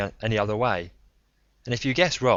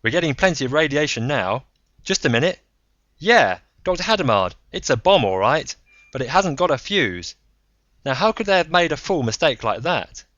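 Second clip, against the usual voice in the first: higher, louder voice through a speaker; radio report; 8 semitones higher, 8 dB louder than usual. Acted. Monig reports over the speaker.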